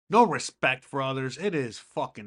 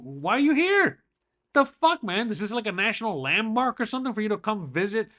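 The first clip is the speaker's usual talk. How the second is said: raised, higher-pitched retelling of annoyance; exasperated confrontation; 6 semitones higher, 3 dB louder than usual. Retold or imitated. YouTuber confronts fans at his house, exasperated. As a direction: indignantly challenge an unwelcome visitor.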